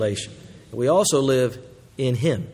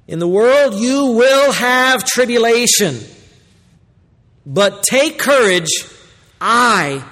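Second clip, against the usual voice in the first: slow, drawn-out, higher preaching; slow declaiming preacher voice; solemn proclamation; 6 semitones higher, 4 dB louder, slower than usual. Acted. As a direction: lay each word down like a promise. Preacher proclaims Christ's words slowly.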